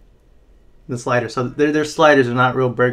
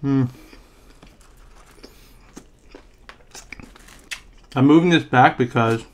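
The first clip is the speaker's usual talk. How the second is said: chewing while talking; speaking between bites; mouth full. Real, not acted. Seen 0: Lips closed, cheeks full after eating slider, chewing through first seconds.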